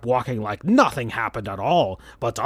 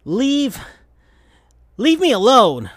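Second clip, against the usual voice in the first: higher, louder angry rebuff; angry brush-off; 9 semitones higher, 3 dB louder than usual. Acted. Sonic telling Tails to go away, angry. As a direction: push him away. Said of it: "in the angry way like before".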